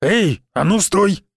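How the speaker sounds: barked order. A narrator's reading. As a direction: bark at someone trying to slip away. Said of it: "гаркнул на меня здоровяк". (гаркнуть, резкий окрик)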